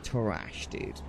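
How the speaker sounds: "trash" said low and soft; hushed verdict. Real, not acted. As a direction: drop "trash" quietly, like a grim admission. First take of drawn-out verdict.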